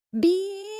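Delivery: high electronic beep; a single high-pitched beep like a monitor; imitated alarm beep. A narrator's reading. Mimicking the hospital alarm going off, high beep.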